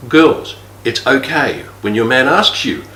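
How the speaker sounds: usual speaking voice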